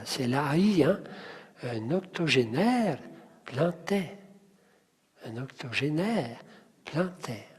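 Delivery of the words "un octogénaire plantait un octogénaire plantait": soft, lean on "-naire" in "octogénaire" and "-tait" in "plantait"; stress on last syllables. Shown as a demonstration. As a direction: pause after "octogénaire", lengthening its last syllable. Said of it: "je vous invite à mettre l'accent". (accent sur la fin de « octogénaire » et de « plantait »)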